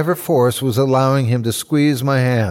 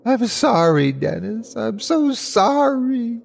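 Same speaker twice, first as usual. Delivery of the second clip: high, tearful apology, drawn out at the end; crying, in tears; 11 semitones higher than usual. Acted. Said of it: "I cried, fully in tears".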